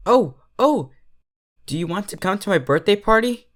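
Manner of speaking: high, fast, excited filly; eager invitation. Acted. Derpy inviting the kind stranger to her party, eager and fast.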